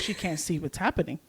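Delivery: ordinary delivery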